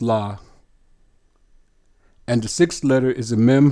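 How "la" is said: "La" as one isolated syllable; "La" with an open ah; pronunciation demo. Shown as a demonstration. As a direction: sound out "La" for learners. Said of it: "How is it pronounced?".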